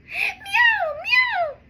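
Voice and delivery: very high mewing; squeaky kitten meows; cat meow. Acted. Cats meowing in story.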